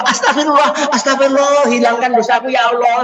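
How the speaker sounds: high, loud pleading prayer with a laugh in it. Acted. A parent pleads to God after the child's mishap, high and loud. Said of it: "Atau mengiba".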